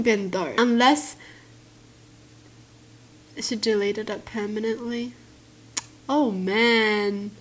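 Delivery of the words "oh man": ordinary talk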